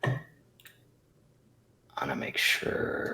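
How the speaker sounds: whispered aside off mic; whispering. Real, not acted. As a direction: whisper it under your breath. Aside while moving things.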